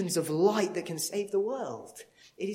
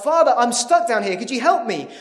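loud, higher call to the priest; desperate shout from the hole; 6 semitones higher, 14 dB louder, faster than usual. Acted. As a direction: yell up to the passing priest. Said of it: "and the guy shouts out".

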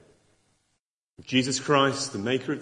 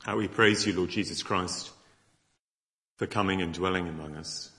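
closing prayer; 4 semitones lower than usual. Acted. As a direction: lead the room in praise, unhurried.

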